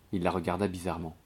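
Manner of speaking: ordinary delivery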